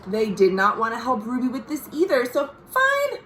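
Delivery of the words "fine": very high, exasperated "Fine"; huffy fine. Acted. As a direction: toss it off like a fed-up kid. Ruby's huffy reply again.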